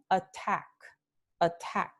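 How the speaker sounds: "attack" stressed on the second syllable; aspirated t into a stressed "-tack" in "attack"; acento final. Shown as a demonstration. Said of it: "antes de vocal acentuada". ("attack" con el acento en la segunda vocal)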